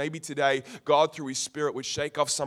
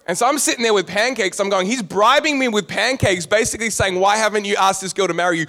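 excited; 5 semitones higher, 7 dB louder than usual. Real, not acted. Excited retelling of the moment.

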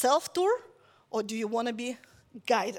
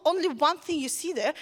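usual speaking voice

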